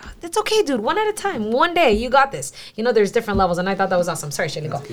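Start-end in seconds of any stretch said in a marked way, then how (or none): 0.0-2.5 telling a newcomer it's okay, high and tender; encouraging reassurance. Retold or imitated. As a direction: cheer on a beginner gently. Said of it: "it's like".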